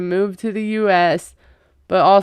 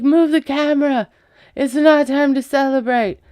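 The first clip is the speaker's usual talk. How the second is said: mock tantrum; 6 semitones higher than usual. Mocking Jasmine's tantrum on video call.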